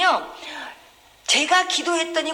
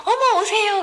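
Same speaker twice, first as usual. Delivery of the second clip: high, louder voiced-up welcome; delighted welcome; 9 semitones higher, 5 dB louder than usual. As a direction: exclaim it like a thrilled host, imitated. Delighted deacon on the phone.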